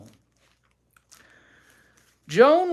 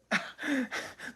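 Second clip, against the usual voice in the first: breathy laugh; 13 semitones higher, 8 dB quieter than usual. Real, not acted. Laughing amid quarrel.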